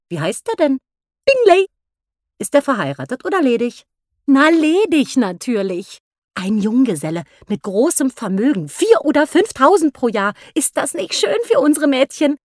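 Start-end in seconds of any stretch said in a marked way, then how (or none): 1.3-1.7 viel höher und lauter; eager name drop. A narrator's reading. Mrs Bennet names newcomer. 4.3-12.4 entzückte Mutter, etwas höher; delighted Mrs Bennet. A narrator's reading.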